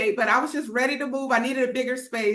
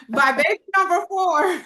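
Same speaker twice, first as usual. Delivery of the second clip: laughing; 7 semitones higher, 5 dB louder than usual. Real, not acted. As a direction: giggle out the correction, louder and higher.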